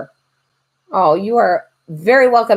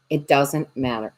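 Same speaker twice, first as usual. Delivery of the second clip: ordinary delivery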